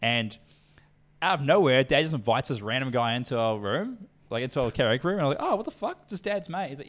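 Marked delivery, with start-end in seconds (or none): 5.4-6.7 shocked. Retold or imitated. Shocked at Dad's random guest.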